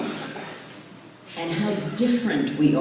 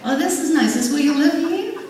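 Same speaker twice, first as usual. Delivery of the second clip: high, louder put-on visitor voice; visiting in-laws; 13 semitones higher, 5 dB louder, faster than usual. In-laws arriving at her parking lot, mock-polite curiosity. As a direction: mimic guests politely taking in the place. Acting out visiting in-laws' first reaction.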